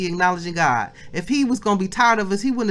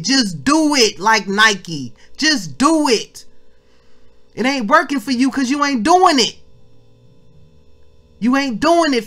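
louder, driving sermon voice with punched words; fired-up preaching; 5 semitones higher, 5 dB louder than usual. Acted. Preacher exhorting the family to acknowledge God, louder. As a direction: preach it at full force to the congregation. Preacher building to full force.

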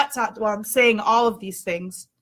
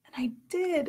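whisper; 5 semitones higher, 10 dB quieter than usual. Retold or imitated.

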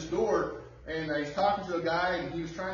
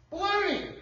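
high, loud, scornful dismissal; scornful shout; 11 semitones higher, 3 dB louder than usual. Real, not acted. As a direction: throw out the word like a verdict. Preacher dismisses the excuses.

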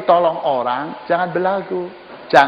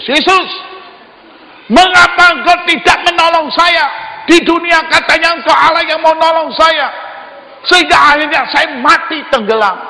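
loud, high, protesting shout at Jesus; indignant complaint; 13 semitones higher, 7 dB louder than usual. Acted. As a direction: complain to Jesus at full voice, outraged. Drowned man complaining to Jesus.

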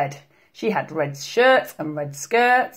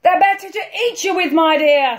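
high, fast, loud wolf snarl; ravenous wolf at full tilt; 11 semitones higher, 5 dB louder than usual. A narrator's reading. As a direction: snarl it and pounce. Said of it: "snarled the wolf".

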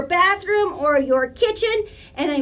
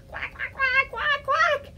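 high, softer puppet duck quacking; duck quacks; 8 semitones higher, 5 dB quieter than usual. Pretend. Puppet duck answers the librarian, high soft quacks. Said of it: "Do you like that one, Duck?".

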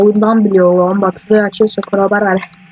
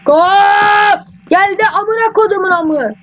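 triumphant shout; 10 semitones higher, slower than usual. Real, not acted. Gamer cheering his own throw, a high triumphant shout. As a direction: celebrate like you just scored. Celebrates throwing item successfully.

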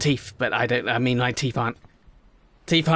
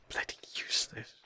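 whispered insult; 16 dB quieter than usual. Real, not acted. Mutters after failure.

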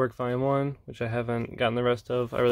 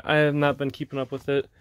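normal talking voice

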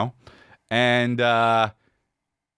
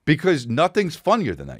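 ordinary delivery

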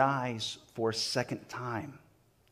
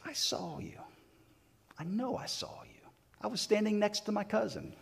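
near-whispered, insistent accusation; hushed accusation; 7 semitones higher, 8 dB quieter than usual. Retold or imitated. Relative of the injured man confronting Peter, hushed accusation. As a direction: accuse him softly, pitch lifting as you insist.

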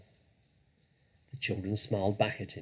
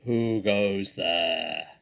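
slow, drawn-out challenge from above; long-drawn, booming challenge; deep voice; 6 dB louder, much slower than usual. A narrator's reading. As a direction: call out slowly like a looming sentry. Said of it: "a deep voice above their heads said".

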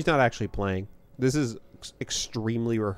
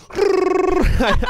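slow, high and loud, breaking into laughter; laughing; 9 dB louder than usual. Real, not acted. Telling her friend about her own button mashing, laughing. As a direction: stretch it out while laughing. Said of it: "I'm like".

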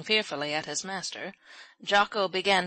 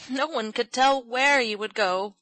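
mildly anguished, raised pitch; worried, higher-pitched protest; distressed cry; 5 semitones higher, 4 dB louder than usual. A narrator's reading. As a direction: cry it out, wringing your hands. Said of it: "cried Polly, wringing her hands".

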